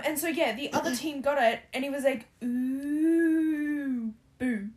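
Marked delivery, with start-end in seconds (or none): 2.4-4.7 long drawn-out ghostly ooh; spooky ooh sound effect; ghost noise. Retold or imitated. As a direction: imitate a ghost, stretched and raised. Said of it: "had to make a sound effect".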